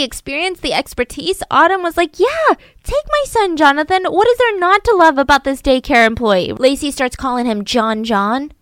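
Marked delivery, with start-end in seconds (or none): 2.2-6.5 high, delighted yes; eager young mom. Retold or imitated. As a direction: gush with relief at the offer. Autumn gladly accepts.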